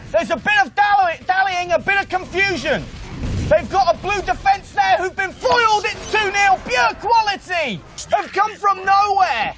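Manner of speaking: high, shouty live commentary; excited play-by-play. Acted. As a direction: call the action at full pitch.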